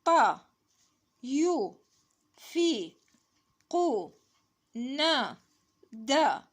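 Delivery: loud, high, isolated syllables; syllable drill. Shown as a demonstration. Teacher reading syllables to children, loud syllable drill. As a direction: call out each syllable separately, pitch falling.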